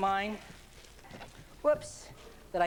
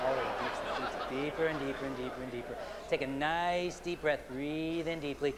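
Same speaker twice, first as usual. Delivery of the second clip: deepening trance; 4 semitones lower, slower than usual. Acted. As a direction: sink her deeper and deeper. Re-hypnotizing skeptic.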